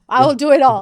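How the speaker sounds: loud defiant promise; defiant vow. Real, not acted. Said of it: "so keep telling me no".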